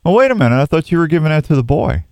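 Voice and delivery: normal talking voice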